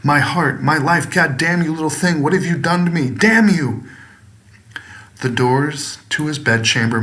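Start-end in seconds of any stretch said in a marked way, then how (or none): 0.0-3.8 a man's anguished shout at the little creature; agonized shriek. Acted. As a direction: cry out in anguish as the horror dawns. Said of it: "shrieked out in an agonizing cry".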